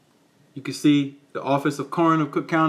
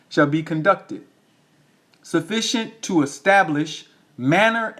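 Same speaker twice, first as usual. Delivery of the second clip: deliberate read-out, drawn out and pitched up toward the end; slow reading; 2 semitones higher than usual. Real, not acted. Reading the medical examiner's powers to listeners, slow and emphatic. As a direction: spell out each phrase with weighty pauses. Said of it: "I'm gonna read it slow".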